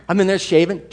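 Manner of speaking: usual speaking voice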